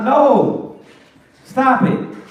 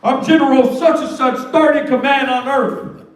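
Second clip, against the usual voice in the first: self-important general; 4 semitones higher than usual. Acted. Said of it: "tell the angel".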